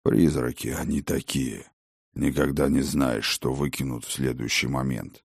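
a wary dragon, grumbling; low dragon voice. Acted. Dragon on ghosts.

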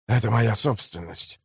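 low, soft claim; restrained slave trader. A narrator's reading. Slave trader claims the book from the mayor, quietly firm. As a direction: assert it quietly, without raising the voice.

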